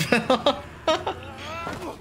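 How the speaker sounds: high giggling laugh. Real, not acted. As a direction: burst out laughing. Big laugh.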